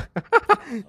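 short high cackle; high gleeful laugh. Real, not acted. Gamer laughing at enemies he drove mad, gleeful.

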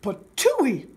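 loud spat-out exclamation; Papa Bear crying out. Acted. Papa Bear spitting out hot porridge, loud outburst. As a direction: spit it out as a big old bear. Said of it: "cried the big old Papa Bear".

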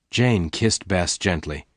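usual speaking voice

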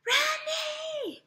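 very high, loud squeal of a name; screaming fangirls. Acted. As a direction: shriek it like a swooning fan. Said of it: "las chicas gritaban". (grito agudo de admiradora)

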